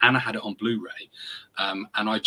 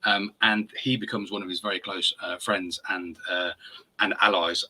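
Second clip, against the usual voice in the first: ordinary talk, nothing marked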